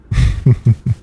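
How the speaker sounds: short sly chuckle; teasing chuckle. Acted. Boss laughs at her obedient employee, a sly chuckle.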